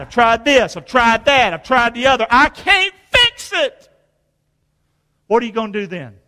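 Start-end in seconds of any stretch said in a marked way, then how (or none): 2.3-3.9 despairing cry. Retold or imitated.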